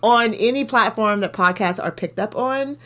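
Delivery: upbeat sign-off plug; podcast outro plug. Acted. Host asks listeners to follow and donate, as an upbeat outro. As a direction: sell the podcast warmly.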